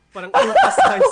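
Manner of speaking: laughing shout. Real, not acted. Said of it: "sisigaw ako to".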